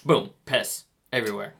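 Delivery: ordinary delivery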